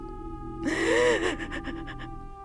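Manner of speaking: high breathless gasp; a sharp shocked intake of breath. Acted. Shocked reaction mid-argument.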